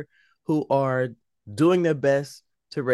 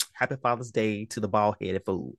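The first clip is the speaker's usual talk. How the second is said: a hint of a dry, lower, softer mock voice; imitating co-host; 4 semitones lower, 5 dB quieter than usual. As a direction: lightly voice her teasing him. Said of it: "you'll be like".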